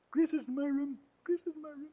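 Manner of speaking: put-on high tattling child voice; child complaint. Shown as a demonstration. Minister suggests a tattling line about Grace, high and whiny. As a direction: tell on your sister in a whine. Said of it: "What about".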